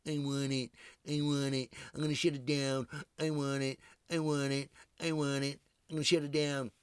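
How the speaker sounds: put-on Trump tantrum, stretched vowels; whiny Trump impression. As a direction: whine the demand out like a stubborn child. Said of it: "Trump is not gonna move until he gets that wall".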